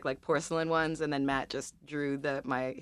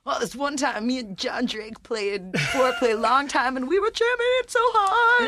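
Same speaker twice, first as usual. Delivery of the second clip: much higher, louder bragging voice; mock bragging rocker; 10 semitones higher, 5 dB louder than usual. Mocking how a bandmate tells rock band stories, higher and boastful. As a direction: ham up a boastful rock-band war story.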